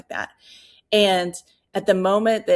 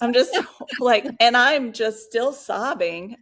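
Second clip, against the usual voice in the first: laughing and emotional; 5 semitones higher, 5 dB louder than usual. Real, not acted. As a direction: laugh it off while still moved. Laughing retelling of still sobbing.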